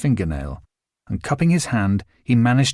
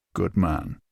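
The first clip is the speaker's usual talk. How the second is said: low approving Connery; 5 semitones lower, 5 dB quieter than usual. A narrator's reading.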